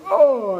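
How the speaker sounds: high, falling cry of pain; quick mock wail; giant's groan. Storyteller as the giant smacked by the rock, high falling groan. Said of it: "the giant goes".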